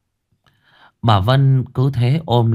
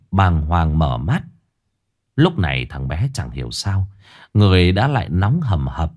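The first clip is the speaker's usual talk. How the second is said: ordinary delivery, nothing marked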